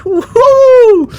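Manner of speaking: gleeful high-pitched cheer; woo-hoo cheer. Real, not acted. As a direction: let out a big celebratory whoop. Cheering at end of video.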